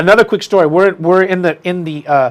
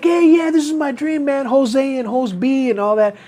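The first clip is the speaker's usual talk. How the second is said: mimicking friend; 8 semitones higher than usual. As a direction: ham up the eager friend. Imitating Hackelman's friend.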